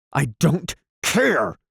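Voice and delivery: high, slow, strained cry; almost shrieking it; man nearly shrieks in rage. A narrator's reading. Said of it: "he almost shrieked".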